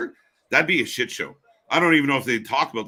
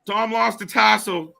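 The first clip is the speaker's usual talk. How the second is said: excited reaction; 6 semitones higher, 3 dB louder than usual. Real, not acted. Calling out a mishap while watching.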